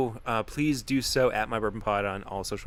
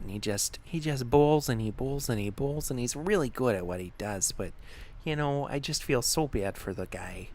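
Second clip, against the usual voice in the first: softer, fretting put-on voice; guilt-ridden parent making excuses to leave; worried parent; 3 dB quieter than usual. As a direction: fret softly over a hungry child. Plays a worried parent character.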